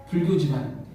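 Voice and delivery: usual speaking voice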